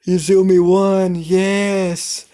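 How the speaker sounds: high, loud group cheer; crowd cheering. Acted. Everyone at the party cheering Izumi's win, in a high loud cheer.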